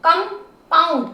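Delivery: Compound slowly, as कं and pound; segmented, drawn-out compound; syllable by syllable. Shown as a demonstration. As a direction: sound out both parts of compound. Teacher splits word into syllables.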